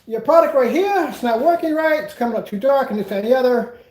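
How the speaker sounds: high, loud whiny customer voice; imitating a complaining client. Businessman retelling a client's complaint, high, loud whiny voice. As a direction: mimic the client demanding a refund, high and loud. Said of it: "they go".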